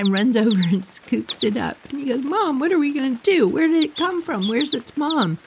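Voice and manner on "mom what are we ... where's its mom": high, fretful questions to mom; retold pleading, pitched up; imitating worried son. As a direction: play a son at a loss, voice raised in pitch. Son appeals again about the chick.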